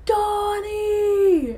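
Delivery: high, long drawn-out holler; distant call. Retold or imitated. Rescuers searching in the distance, high drawn-out shout. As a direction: imitate friends calling from far away. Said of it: "in the distance, I hear".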